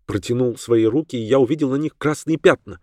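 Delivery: usual speaking voice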